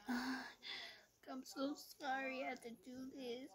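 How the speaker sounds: high, fake-teary apology; mock crying. Breaking up with her boyfriend in person, fake teary. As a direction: put on a weepy act to let him down. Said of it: "I fucking started crying".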